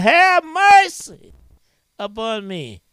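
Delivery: high, loud pleading cry fading at the end. Retold or imitated. David crying out to God for mercy, high and pleading. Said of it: "he cried out".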